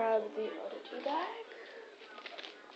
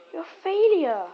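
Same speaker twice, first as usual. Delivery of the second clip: louder, teasing scold; mock scolding; 5 semitones higher, 10 dB louder than usual. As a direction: tease the dog for failing.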